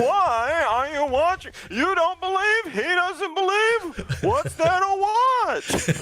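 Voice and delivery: Seinfeld impression. Called Seinfeld, he hams up the fiance's rant, high whiny Seinfeld voice. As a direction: lean into a full hammed-up Jerry Seinfeld rant. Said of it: "You sound like Jerry Seinfeld now".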